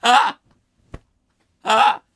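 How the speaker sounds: loud helpless laughing shriek; cracking up for real; real burst of laughter. Real, not acted. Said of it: "I just find this so hilarious".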